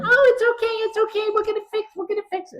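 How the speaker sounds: tiny, very high-pitched elves; elf voices. Acted. Elves reassuring a frustrated Santa, high elf voices. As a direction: squeak it out like reassuring little elves. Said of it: "The elves came in".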